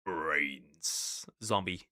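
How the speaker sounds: low, soft zombie groan. Pretend. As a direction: moan it like a zombie. Zombie voice after brains.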